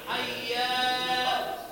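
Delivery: حيا said slow with a long added alif after the ي; wrong added alif. Shown as a demonstration. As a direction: drag حيا out with an extra ا at the end. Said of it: "لا تأتي بألف بعد الفتحة". (نطق خاطئ بألف زائدة بعد الياء)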